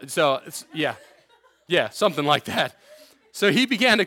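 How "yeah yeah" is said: ordinary delivery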